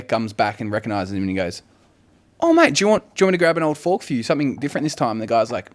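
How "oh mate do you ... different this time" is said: high, loud, quick matey offer; acted excited waiter, pitched up; eager waiter greeting. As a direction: greet a regular in a raised, hurried voice. Waiter eagerly greets returning customer.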